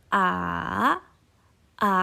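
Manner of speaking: dipping "A", down then up; long falling-rising "a"; third tone. Shown as a demonstration. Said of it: "turun dulu, baru naik lagi".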